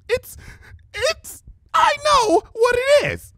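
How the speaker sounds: high, slow tearful snap; snapped. Acted. Lavender unicorn snaps back at Rarity, tearful snap. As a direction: snap it through near tears. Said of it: "the lavender unicorn snapped".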